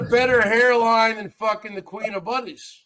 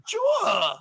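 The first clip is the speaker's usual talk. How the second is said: put-on Long Island accent; 12 semitones higher, 3 dB quieter than usual. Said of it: "Long Island accent".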